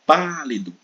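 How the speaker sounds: pálido called high and loud, long PÁ; pálido as a loud call, leaning on its first syllable; stressed syllable stretched. Shown as a demonstration. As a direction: drag out the PÁ of pálido. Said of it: "arrastar um pouco mais".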